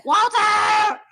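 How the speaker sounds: hammed-up screech for the husband; yelling Walter. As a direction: call him out at full volume. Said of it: "She did yell, "Walter!".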